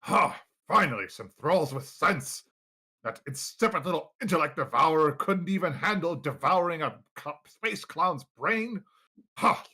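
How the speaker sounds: pompous, indignant master; haughty commanding voice. Acted. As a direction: complain grandly, then order the thralls on. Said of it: "you hear a deep reverberating voice".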